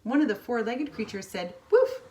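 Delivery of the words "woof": high, loud playful woof; a bright bark pitched way up; dog bark. Acted. Dog barks in story.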